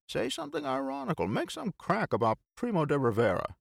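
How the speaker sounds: high, coaxing and pushy; Bill voice. A narrator's reading. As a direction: egg him on.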